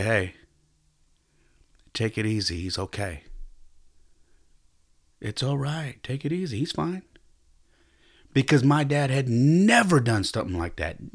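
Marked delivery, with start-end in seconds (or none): 0.0-7.0 gently calming, much softer; soft reassurance. Retold or imitated. Grandfather soothing his son about the toddler, soft and kind. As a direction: settle him down kindly. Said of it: "felt the need to say".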